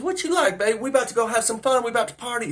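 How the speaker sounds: high-pitched party invitation; imitated flirting woman. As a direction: coax him to party in a high voice. Acting the woman at the window.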